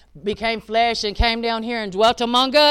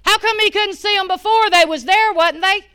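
higher and louder, preaching it hard; full force preaching; 9 semitones higher, 8 dB louder than usual. Acted. Preaching the prophet's prayer to the congregation, full force. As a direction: preach the miracle out to the church.

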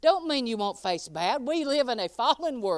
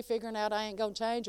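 ordinary talk

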